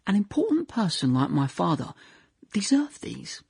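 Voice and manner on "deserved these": whisper. Real, not acted. Narrator whispering an ironic aside to audience. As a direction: drop to a whisper.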